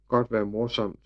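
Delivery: ordinary delivery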